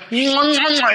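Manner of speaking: smack out three kisses; double air kiss. Retold or imitated. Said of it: "stick their grandma lips out like this".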